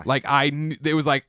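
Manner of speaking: ordinary talk, nothing marked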